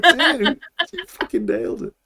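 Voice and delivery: amazed praise breaking into laughter; impressed. Retold or imitated. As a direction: cheer the kid on through a laugh. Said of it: "It's like".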